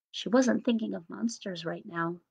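ordinary delivery

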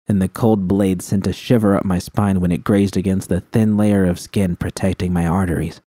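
usual speaking voice

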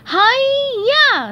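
high, loud karate cry; high squeaky battle yell. Acted. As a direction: shout it as you strike. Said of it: "SpongeBob yelled".

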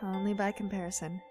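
muttered aside. Acted. Friend quietly repeating a put-down of Ash, muttered aside. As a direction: mutter it under the breath.

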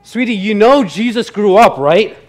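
lightly voiced wife, gently correcting; wife interjecting. Wife cuts into the grace, mildly voiced wife. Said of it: "his wife interjects and says".

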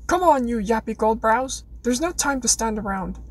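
hammed-up British accent, taunting; bad British accent. Rona taunting Lysander amid the flames, in a bad British accent. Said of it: "I'm terrible at this accent".

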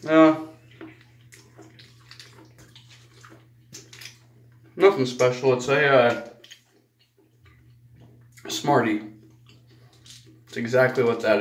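candy in mouth; tasting candy while speaking; talking while chewing candy. Real, not acted. Seen 0: Put candy in mouth around -9; jaw and lips move as if chewing.

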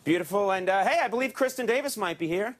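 normal talking voice

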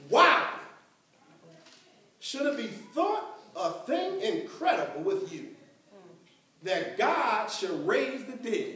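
slow, measured Bible reading with long pauses; solemn verse read in separated phrases; scripture reading. Acted. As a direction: land the opening word hard, then read deliberately. Reads scripture aloud.

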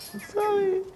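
very high squeaky sorry; mock falsetto apology. Repeats apology in a silly voice.

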